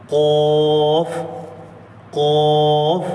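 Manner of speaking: قوف said heavy from the back of the tongue; qaf demo. Shown as a demonstration. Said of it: "زبان کی جڑ".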